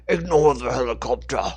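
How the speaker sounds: loud commanding shout; yelling order. Acted. Cult leader yells at his men to ignore the helicopter, loud commanding shout. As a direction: bark it across the battlefield.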